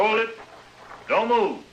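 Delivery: jumpy shout of warning; nervous challenge. Acted. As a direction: call out to freeze whoever is there. Startled man challenges intruder.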